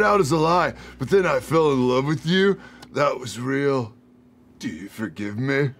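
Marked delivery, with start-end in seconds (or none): 2.9-5.7 earnest confession. Acted. Brett begging Gale to forgive him, earnest and soft. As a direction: plead softly, dropping low.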